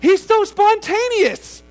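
high, loud, slow swooning gush. Retold or imitated. New wife gushing about her husband, high and swooning. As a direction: swoon over him like a smitten bride. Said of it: "And it's like".

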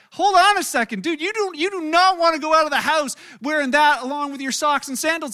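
hammed-up shout of a horrified friend; friends' mock warning. Friends stopping him at the door, hammed-up loud alarm. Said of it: "they're like".

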